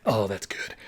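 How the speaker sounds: low, soft murmur of pleasure; softly acted contentment; savouring sigh. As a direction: savour a scent quietly. Savouring the smell of wife's dress.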